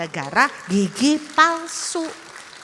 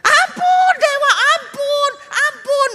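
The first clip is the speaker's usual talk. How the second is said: much higher, louder, slower cries for mercy; villagers' frantic, loud pleas for mercy; more terrified begging; 10 dB louder, slower than usual. Acted. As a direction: plead in growing terror. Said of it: "Mereka tambah ketakutan".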